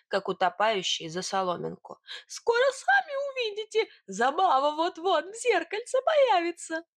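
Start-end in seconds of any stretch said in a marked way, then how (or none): 2.3-6.8 very high piping little-man voice; high-pitched insistent cry; squeaky Sidor. Acted. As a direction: squeak out the promise.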